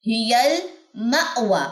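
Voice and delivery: very slow ہی المأوی with a catch on the hamza; jolt on hamza. Shown as a demonstration. Teacher reciting ہی المأوی slowly, hamza caught. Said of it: "اس پہ ہم جھٹکا دیں گے".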